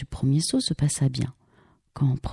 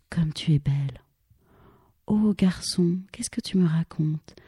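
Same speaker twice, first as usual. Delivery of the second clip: tender whisper. Acted. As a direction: breathe the words to her. Mots tendres chuchotés. (murmure tendre, chuchoté)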